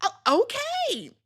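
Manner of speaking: high, slow, drawn-out okay; sarcastic okay. Retold or imitated. Host reacting to Angel showing personality, high drawn-out sarcastic okay. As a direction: stretch the okay in mock approval. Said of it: "And I'm like".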